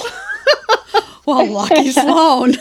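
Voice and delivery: high, loud, drawn out through laughter; laughing retort. Retold or imitated. Recalling her reply about the interview, laughing. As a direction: crack up while saying it.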